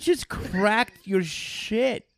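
slow, loud, stunned exclaim; half-laughing shocked outcry; shocked disbelief. Real, not acted. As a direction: drag it out in disbelief. Reacting to the neck crack.

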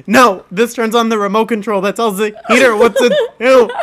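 loud, high, laughing retelling; hammed-up exasperated roommate; exasperated shouting. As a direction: shout the explanation through amusement. Exasperated roommate explains the obvious.

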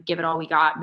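ordinary delivery

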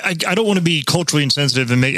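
usual speaking voice

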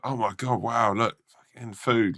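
high-pitched, wowed excitement; excited. Acted. Rats seeing food for everyone, excited. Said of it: "they're all excited".

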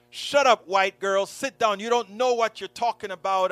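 loud, high mock telling-off; retold shout. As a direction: bark it like the girls who snubbed her.

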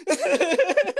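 high giggle through the words; laughing. Real, not acted. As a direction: laugh while talking.

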